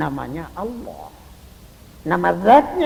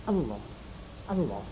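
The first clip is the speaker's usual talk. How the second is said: lower, much softer, short repeated; soft whisper; 7 semitones lower, 12 dB quieter than usual. Shown as a demonstration. Preacher whispering God's name to the dying. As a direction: murmur the name gently, over and over. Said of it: "Bacakan saja Allah".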